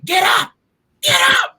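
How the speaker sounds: high, loud shout of get up; dad screaming his kid back onto his feet; yelling dad. Retold or imitated. Dad on the field yelling at his crying son, loud shout.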